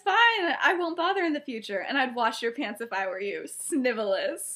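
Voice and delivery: high, loud opening easing into a cutting insult; acting out a wounded friend's comeback; retold scornful retort. Lily's acted scornful retort.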